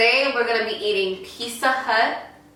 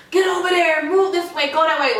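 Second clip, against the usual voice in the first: higher, louder barked commands; yelling orders; 8 semitones higher, 3 dB louder than usual. Retold or imitated. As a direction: yell orders like a drill instructor. Retelling instructors yelling orders.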